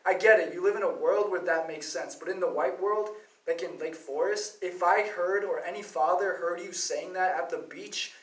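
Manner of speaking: normal talking voice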